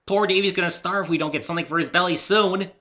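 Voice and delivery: high, loud mock-serious fretting; bubbly pony playing up a crisis; Pinkie's voice. Pinkie worries for Dave.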